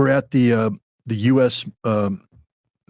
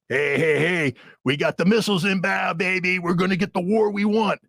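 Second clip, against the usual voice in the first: much higher, gloating mock voice; Madeleine Albright bragging; 7 semitones higher than usual. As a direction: crow it like a smug diplomat bragging to a colleague. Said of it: "and she's bragging".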